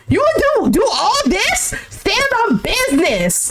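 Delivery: high, emphatic insistence; impassioned scolding; exasperated demand. Real, not acted.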